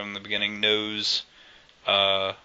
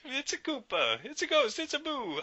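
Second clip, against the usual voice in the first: put-on very high squeaky Italian-accented voice, softer; Italian Miyamoto; 5 dB quieter than usual. Riffing on Miyamoto, very high Italian voice. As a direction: toss out game ideas as the squeaky Italian boss. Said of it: "I don't know why he's Italian".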